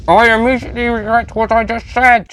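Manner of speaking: high, far-off robotic scream. A narrator's reading. Cyber Lieutenant screaming as the building collapses, high. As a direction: cry out as the building falls. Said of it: "screamed the Cyber Lieutenant".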